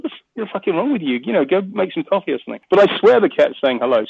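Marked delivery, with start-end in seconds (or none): 0.0-2.6 retold brush-off, higher pitched; exasperated partner. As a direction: imitate a partner dismissing a silly claim. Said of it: "She's like".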